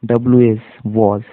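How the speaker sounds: usual speaking voice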